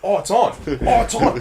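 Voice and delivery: playing a bird itching to fight; hammed-up battle call; mock fight challenge. Acting a magpie squaring up.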